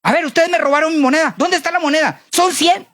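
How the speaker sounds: high, fast mock alarm; indignant accusation. Servant hunting for the missing coin, indignant. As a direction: demand it back, rising in pitch.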